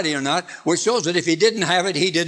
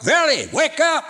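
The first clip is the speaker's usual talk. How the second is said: shouted much higher and louder, "Verily, wake up" flung out; emphatic shout; 11 semitones higher, 3 dB louder than usual. Shown as a demonstration. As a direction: repeat it as a big shouted wake-up. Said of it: "God just says it twice".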